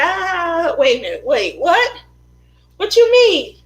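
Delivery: high, rising to a loud incredulous "what you mean"; aunt's sudden realisation. Retold or imitated. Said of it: "She said".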